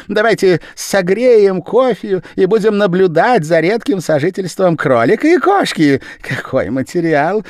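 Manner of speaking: very high, loud, rushed patter; fast-talking Japanese man. A narrator's reading. The Japanese man pattering at the gentleman, high and loud. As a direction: talk him round in a rush.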